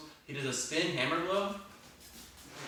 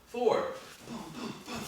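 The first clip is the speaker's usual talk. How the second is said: boxing coach calling combos; 10 semitones higher than usual. Shown as a demonstration. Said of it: "counting reps with strikes".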